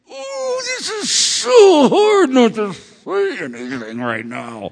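high, drawn-out strained wail; agonized restraint. Retold or imitated. Father fighting not to answer his son, agonized wail. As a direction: strain it out as if barely holding back. Acts out struggle to stay silent.

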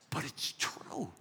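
slow whispered admission. Retold or imitated. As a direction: breathe it out slowly, barely voiced. Retold confession to his wife.

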